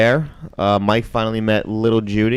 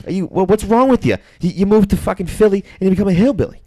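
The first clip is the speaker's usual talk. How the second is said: high-pitched, imitated scolding; mock exasperation; 8 semitones higher than usual. Cousin berating Judy for turning hillbilly, exasperated. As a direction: scold in disbelief, pitched well up.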